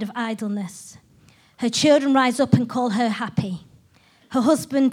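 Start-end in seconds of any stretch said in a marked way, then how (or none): none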